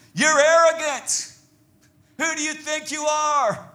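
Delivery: high, loud acted accusation; accusing. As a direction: hurl it like an outraged brother. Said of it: "His brother was accusing him".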